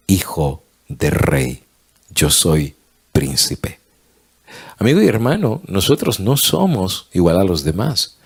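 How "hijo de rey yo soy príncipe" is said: slow, hushed reveal of royal identity; awed whisper. Acted. Prince telling the king who he is, slow whisper. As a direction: breathe it out like a precious secret.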